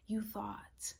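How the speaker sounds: teasing unvoiced whisper. Real, not acted. Astrologer teasing viewers in a whisper. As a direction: lean in and whisper the tease. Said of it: "whispered aside".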